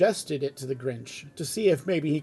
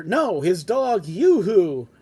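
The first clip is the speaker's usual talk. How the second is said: emphatic correction; 3 semitones higher, 6 dB louder, slower than usual. A narrator's reading. First man corrects the woman.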